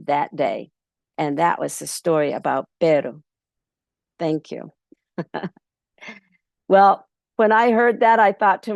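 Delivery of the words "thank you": ordinary delivery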